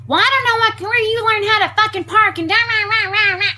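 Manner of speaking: high, loud mocking rant trailing into rah rah; mock her endless yelling; hammed-up shrill scolding; imitated ranting woman. Woman's rant voiced again.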